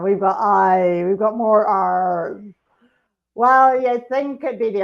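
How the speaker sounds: slow, loud, hammed-up pirate. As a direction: growl it out like a pirate. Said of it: "Talk Like a Pirate".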